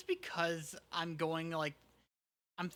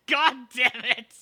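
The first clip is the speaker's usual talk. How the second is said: loud outburst; 6 semitones higher, 16 dB louder, slower than usual. Real, not acted. Laughing outburst at approach.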